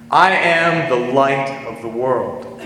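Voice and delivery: slow, weighty stress on "I am", each title spaced out; solemn, drawn-out proclamation of "I am"; I AM in all caps. Retold or imitated. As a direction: lean hard on "I am" and take it very slowly. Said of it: "in all caps".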